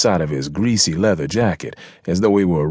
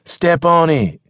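high-pitched urgent order; urgent cry; 11 semitones higher, 4 dB louder, slower than usual. Acted. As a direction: cry out to get the driver moving. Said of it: "Mister Barron cried".

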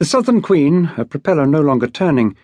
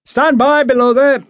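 much higher, loud ship's order; shouting; 11 semitones higher, 4 dB louder than usual. A narrator's reading. Mate shouting a warning down to the boat crew, loud. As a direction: bark it across to the men below. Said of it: "Mister Brett shouted".

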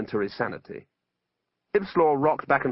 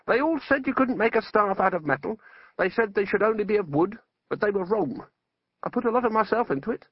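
higher-pitched wizard, mildly aggrieved; a touch of the wronged craftsman, pitched up; lightly voiced wizard; 8 semitones higher than usual. Wizard tells of his staff.

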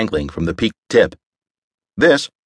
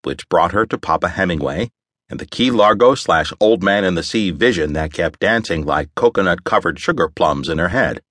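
usual speaking voice

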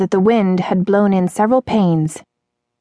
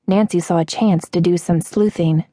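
ordinary delivery, nothing marked

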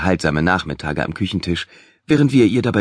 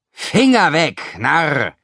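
barking cook, higher; furious chef; 7 semitones higher, much slower than usual. A narrator's reading. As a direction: yell it at the thief. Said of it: "brüllte Bubble".

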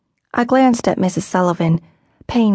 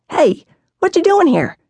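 put-on much higher, brash boy calling out; boy's surprised greeting; 11 semitones higher than usual. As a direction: burst in like a cheeky boy. Jack bursts in.

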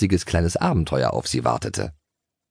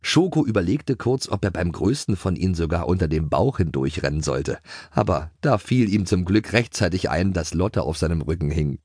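ordinary delivery, nothing marked

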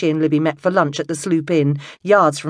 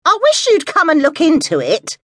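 put-on high, louder, fretful old-lady voice; peevish caller, pitched well up and pushing; querulous; 13 semitones higher, 5 dB louder than usual. A narrator's reading. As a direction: nag down the phone in a high complaining voice. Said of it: "said the querulous voice".

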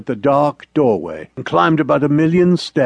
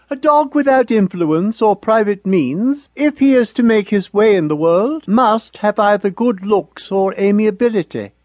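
higher, prim motherly dog lecturing her pup; Mother's voice; 6 semitones higher than usual. A narrator's reading. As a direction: play the proper old mother dog, scolding gently. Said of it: "Mother always said".

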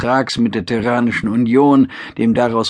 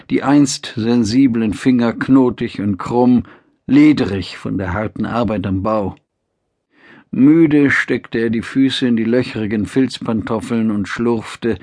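usual speaking voice

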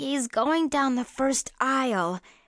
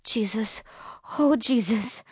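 mild whimper. A narrator's reading. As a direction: whimper the name under your breath. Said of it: "She whimpers".

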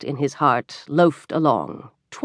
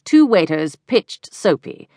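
ordinary delivery, nothing marked

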